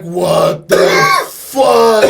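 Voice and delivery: loud, high mock outrage; shocked. Students caught on the bus, loud mock shock. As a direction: blurt it out in disbelief. Retold reaction to the director.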